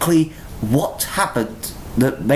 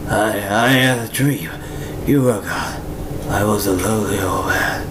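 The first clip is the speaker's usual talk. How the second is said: mournful confession; 10 semitones lower than usual. Retold or imitated. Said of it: "and then he says".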